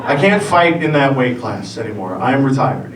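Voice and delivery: normal talking voice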